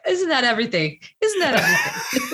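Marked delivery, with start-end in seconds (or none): 1.2-2.3 higher, laughing, rising at the end; laughing repeat. Real, not acted. Repeating the question to her guest with a laugh.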